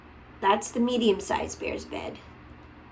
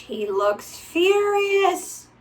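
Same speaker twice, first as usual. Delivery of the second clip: amazed; 9 semitones higher, 4 dB louder, much slower than usual. Real, not acted. Reacting to picture slowly.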